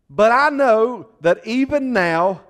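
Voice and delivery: high, loud, slow swell of faith; heartfelt outburst. Retold or imitated. Martha pours out her faith to Jesus, high and loud. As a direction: let it burst from the heart. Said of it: "it just comes out of her heart".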